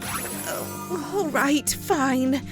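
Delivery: low, soft resignation; reluctant giving in. Acted. Rarity surrendering her scarf, low reluctant sigh of agreement. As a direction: give in quietly, pitch dropping.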